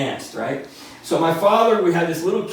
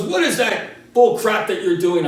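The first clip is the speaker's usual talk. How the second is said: angry dad; 5 semitones higher, 5 dB louder than usual. Retold or imitated. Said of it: "He goes".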